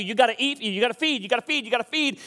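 high, insistent pleading of the body; mock screaming demand. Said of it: "your body will scream out".

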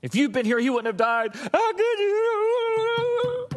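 high-pitched mock wailing, last words drawn out; emotional basket case. Mary blaming Jesus for her brother's death, hammed-up emotional wail. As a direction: break into a hammed-up wail of reproach. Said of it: "in a totally emotional basket case".

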